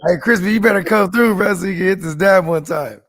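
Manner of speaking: loud playful call to a friend. Real, not acted. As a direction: shout it out to the chat. Calling out to Crispy on stream.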